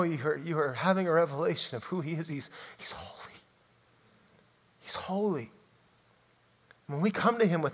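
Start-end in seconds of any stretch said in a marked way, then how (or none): 2.3-5.5 whispered, reverent; hushed awe. Real, not acted. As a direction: drop to an awed whisper. Preacher awed by God's holiness.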